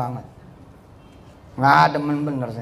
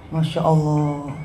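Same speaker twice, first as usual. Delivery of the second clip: slow, drawn-out acted wonder; acted hushed amazement; 3 semitones lower, much slower than usual. Preacher acts out amazement at Rizka's memorising, awed. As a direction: draw it out as if marvelling.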